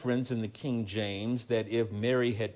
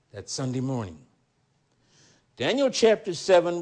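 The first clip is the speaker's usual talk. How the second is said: ordinary talk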